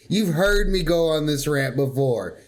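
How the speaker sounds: usual speaking voice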